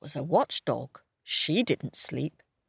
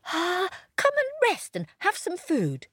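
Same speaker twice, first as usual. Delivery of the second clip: high, eager puppy voice; little dog voice; 13 semitones higher than usual. A narrator's reading. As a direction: squeak it like a small dog. Pip voiced.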